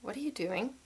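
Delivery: hushed, puzzled question; baffled friend. Retold or imitated. Friend questioning her sudden accent, softly baffled. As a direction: quietly imitate an uneasy friend.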